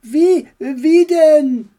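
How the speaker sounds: mild mock panic; panicked question. Max falling from the sky, high alarmed.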